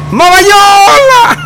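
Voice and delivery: long high stretched call of Mamayola; drawn-out shout. Acted. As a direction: call it out slow and loud like across a field. Repite el grito de saludo. (grito largo y agudo)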